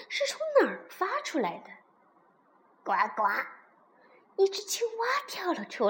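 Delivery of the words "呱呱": low croaking frog call. Acted. Frog announces itself to princess, croaking.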